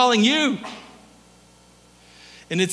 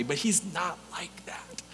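soft whisper; 11 dB quieter, slower than usual. Real, not acted. Softly correcting the angry image.

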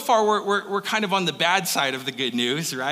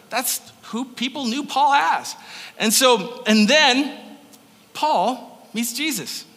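ordinary talk, nothing marked

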